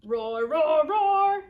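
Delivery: loud roars climbing to the last; playful triple roar; dinosaur roars. Acted. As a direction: build up three dinosaur roars. Reads aloud Dinosaur's roars.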